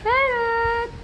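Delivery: very high, held-out call; high-pitched pet call; calling a parrot. Real, not acted. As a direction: sing out high to the bird.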